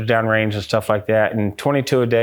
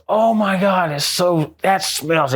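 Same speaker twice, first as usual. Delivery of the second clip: higher-pitched retold disgust at a stink; disgusted reaction; 7 semitones higher, slower than usual. Investigator reacting to a sudden stench upstairs, disgusted. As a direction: recoil from a bad smell, voice raised in pitch. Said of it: "He's, like, holding his nose".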